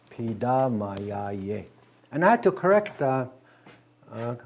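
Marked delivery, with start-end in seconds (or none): 0.1-1.7 Pidamayaye very slow, every syllable stretched; careful Dakota demo. Shown as a demonstration. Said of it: "the correct way to say".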